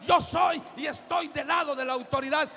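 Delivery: booming declaration of loyalty; declaring from the pulpit. Acted. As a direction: proclaim it with full force. Declares his stance loudly.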